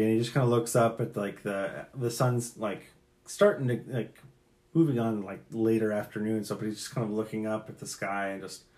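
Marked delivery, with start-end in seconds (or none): none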